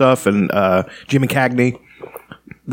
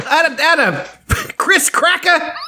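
put-on high old-time radio announcer; high ringside announcer impression; slower than usual. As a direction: call the fight like the thirties radio. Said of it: "they did speak that way".

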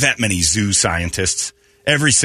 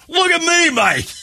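put-on much higher, excited Australian accent; Aussie dive guide calling out, way up high; slower than usual. Australian diver showing off underwater in a high excited Australian accent. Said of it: "you can almost hear his accent".

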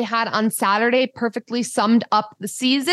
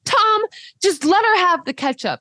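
much higher, pleading mom voice; imitating mom; 9 semitones higher than usual. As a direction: mimic mom begging dad to give in. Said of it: "my mom would go".

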